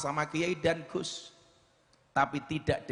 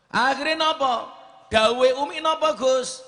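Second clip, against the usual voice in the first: climactic shout; 10 semitones higher, 8 dB louder than usual. Acted. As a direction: build the moment to its peak. Climactic preaching question.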